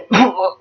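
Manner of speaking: a mock turtle cough; acted choking cough. Acting out the turtle choking for the children, mock cough. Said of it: "She gasped and she spluttered".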